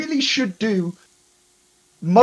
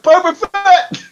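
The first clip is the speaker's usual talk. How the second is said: high, drawn-out fan cheer; excited shout; 11 semitones higher, 7 dB louder, slower than usual. Retold or imitated. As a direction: yell the name with glee. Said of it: "Everyone was just like".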